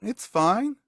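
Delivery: high-pitched woman's reply; townswoman. Acted. Woman answers the visitor about town life, high voice.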